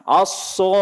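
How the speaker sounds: "aso" with both lips pushed forward; puckered-lip mispronunciation. Shown as a demonstration. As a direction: demonstrate a faulty, over-rounded "aso". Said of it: "itu salah".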